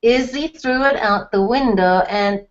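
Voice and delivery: usual speaking voice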